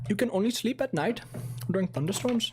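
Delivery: high mock Indian accent. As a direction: put on an exaggerated Indian accent. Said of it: "Is that, like, cursive?".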